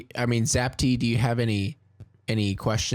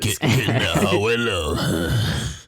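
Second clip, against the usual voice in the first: louder, booming announcer read of the line; radio announcer voice; 3 semitones lower, 5 dB louder, much slower than usual. Acted. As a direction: say it like a late night radio host. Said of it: "You sounded like a radio announcer".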